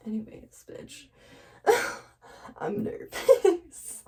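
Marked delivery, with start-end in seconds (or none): 1.7-4.0 laughed out high, slow and airy; real nervous giggle. Real, not acted. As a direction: giggle through the words. Laughing at her own nerves.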